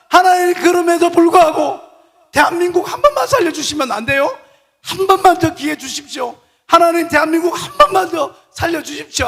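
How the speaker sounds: high, loud begging that slides into song; pleading prayer. Acted. Moses begging God for Korea.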